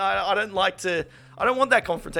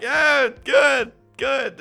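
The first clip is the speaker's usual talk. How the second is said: high, strained fake-happy answer; squeaky polite lie; forced cheerful reply; 10 semitones higher, 5 dB louder, much slower than usual. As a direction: force a chipper good good. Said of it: "I'm just like".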